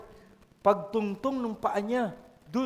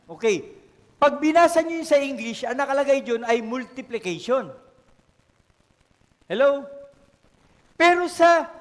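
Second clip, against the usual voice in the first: louder, pressing each point on the congregation; loud preaching; 7 dB louder than usual. Real, not acted. Pastor urging the church on the talents, loud preaching.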